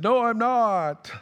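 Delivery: high, drawn-out comic wail; panicked reply. Retold or imitated. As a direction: cry it out in mock desperation. Acts out panicked answer to worker.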